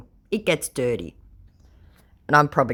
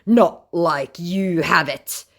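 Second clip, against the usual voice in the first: slow then loud, higher scornful put-on voice; mock scammer; 5 semitones higher, 8 dB louder, slower than usual. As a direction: sneer like a smug conman. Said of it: "said my scammer".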